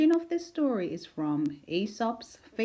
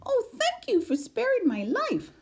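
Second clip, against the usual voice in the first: very high, squeaky grateful mouse; a tiny mouse full of thanks; little mouse. A narrator's reading.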